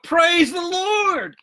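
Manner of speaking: very high, drawn-out praise cry; jubilant shout. A narrator's reading. Woman finds groceries on her porch, high jubilant shout. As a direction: sing out the praise, holding the last word long. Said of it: "caused her to shout".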